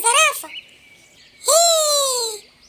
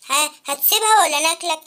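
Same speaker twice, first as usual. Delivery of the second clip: much lower, threatening beast; menacing tiger voice; 10 semitones lower than usual. Acted. Tigers bully the boy off his bike, low. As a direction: growl the threat down at him.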